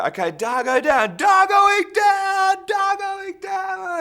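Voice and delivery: high, loud, drawn-out calls. Retold or imitated. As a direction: call the wrap across the studio, slow and high. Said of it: "then you'd hear".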